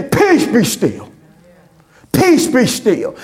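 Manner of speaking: slow, solemn command to the sea; Jesus commanding the storm. Acted. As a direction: speak it like a calm rebuke.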